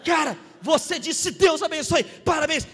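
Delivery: high, loud amazement; excited acclaim. Retold or imitated. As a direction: retell praising someone for a blessing, shouting. Retold congratulation of pilot.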